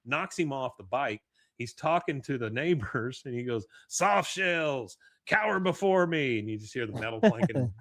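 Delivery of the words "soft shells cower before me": loud, raised mock-villain boast; menacing proclamation. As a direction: proclaim it like an armoured tyrant, hammed up. Dale in armour threatens neighbours.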